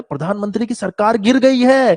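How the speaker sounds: with expression. Retold or imitated. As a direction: sell the headline with alarm. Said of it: "थोड़ा तो expression लेकर आओ".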